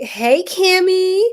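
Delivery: high, loud, drawn-out hello; excited greeting. Real, not acted. Streamer excitedly welcoming a viewer to the live. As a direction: sing out the greeting slow and bright.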